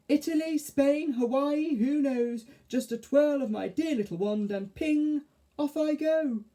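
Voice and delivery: very high, slow fairy voice. Acted. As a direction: coo it like a vain fairy. Mavis fairy voice.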